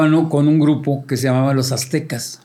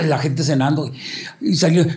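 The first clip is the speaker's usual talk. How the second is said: ordinary delivery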